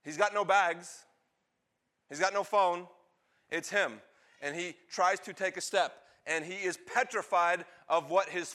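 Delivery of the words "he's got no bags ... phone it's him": ordinary delivery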